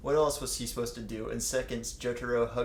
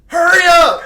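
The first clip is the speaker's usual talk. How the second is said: a piercing high scream, much louder; 22 dB louder than usual. Acted. Reading fanfic, Jotaro screams for Kakyoin, shrill shriek. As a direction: screech it out with everything. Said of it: "Jotaro screamed out".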